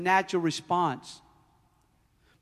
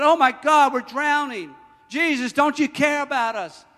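high, loud plea for help; acted panic; 7 semitones higher, 7 dB louder than usual. Disciples pleading with Jesus in the storm, panicked. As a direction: cry out in hammed-up alarm.

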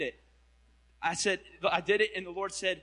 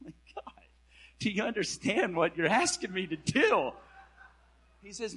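ordinary delivery

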